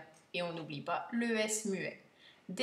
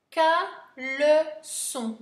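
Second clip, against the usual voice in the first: "caleçon" slow, higher and louder, in separate syllables; 6 semitones higher, 8 dB louder, much slower than usual. Shown as a demonstration. Teacher dictating a new word, syllable by syllable. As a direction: project "caleçon" syllable after syllable.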